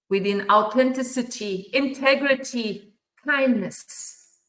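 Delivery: loud, rising list of values; passionate build. Real, not acted. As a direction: call each value out with growing force.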